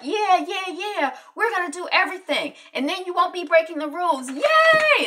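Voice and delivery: put-on high, loud kids cheering; excited kids. The triplets cheer their dad's anniversary plan, high and loud. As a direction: shout it like thrilled children. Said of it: "the kids are all excited".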